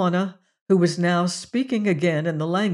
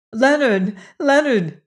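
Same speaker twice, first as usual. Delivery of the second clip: higher, louder urgent calling; 6 semitones higher, 5 dB louder than usual. Acted. Juana calling to Leonard in English, urgent and louder. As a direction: call his name in alarm. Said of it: "cried Juana in English".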